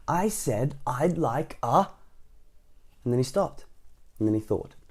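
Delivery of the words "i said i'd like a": slow, higher-pitched mild shout. A narrator's reading. Mister Noisy answering Mister Bacon, mildly shouted. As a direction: space the words out insistently. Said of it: "at the very, very top of his voice".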